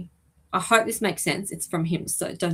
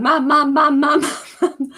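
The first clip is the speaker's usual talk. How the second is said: put-on high, loud playful child calling out for mum, with a laugh; 8 semitones higher, 7 dB louder than usual. Medium voicing a lost loved one calling his mum, high and laughing. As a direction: call for your mum over and over, giggling. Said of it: "Still there going".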